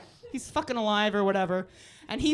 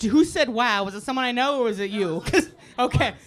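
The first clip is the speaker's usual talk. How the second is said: normal talking voice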